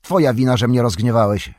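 loud, heated accusation; angry. A narrator's reading. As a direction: throw the blame back hard. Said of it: "rzekł Bilbo".

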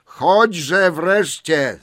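loud, high, very slow call; impatient call. A narrator's reading. Bilbo urges Gollum to come along, calling loudly. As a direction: shout it, stretching each word out. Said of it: "zawołał".

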